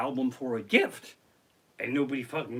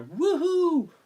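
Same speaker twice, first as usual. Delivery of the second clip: high, loud woohoo; mock crowd cheer; 13 semitones higher, 6 dB louder than usual. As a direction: whoop like a lone fan out there. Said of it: "there's people clapping out there".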